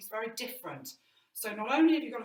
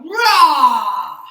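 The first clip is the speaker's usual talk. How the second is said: huge loud roaring Rah; big ferocious roar; lion roar; 5 semitones higher, 22 dB louder than usual. Shown as a demonstration. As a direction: roar like the king of the jungle. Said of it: "he might come back in and go".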